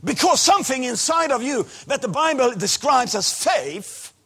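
loud, high, slow fervent preaching; preaching at full force. Acted. Preacher proclaiming faith beats disease, preaching at full force. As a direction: hammer each word home to the crowd.

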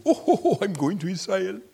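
high, chuckling protest; amused protest. Retold or imitated. Reinhard answering God, high and amused. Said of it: "And he said".

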